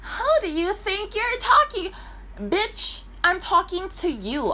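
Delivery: mocking rude woman. Retelling a rude woman's outburst.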